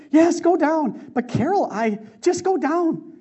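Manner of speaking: high, loud, slow insistence; wife insisting urgently, high and loud. Retold or imitated. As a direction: urge him down the stairs. Wife urging him downstairs.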